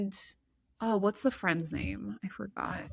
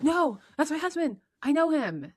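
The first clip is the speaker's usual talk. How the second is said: urgent Terry; 8 semitones higher, 4 dB louder than usual. Retold or imitated. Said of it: "Terry's like".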